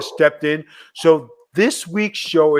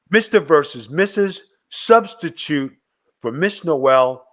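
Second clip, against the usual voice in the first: ordinary talk, nothing marked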